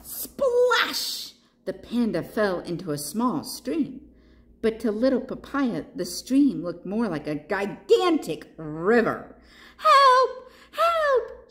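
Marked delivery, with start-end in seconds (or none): 0.0-1.3 high, drawn-out splash; splash effect. A narrator's reading. Sound word in story. 9.8-11.5 very high, loud shout for help; Papaya. A narrator's reading. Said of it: "shouted Papaya".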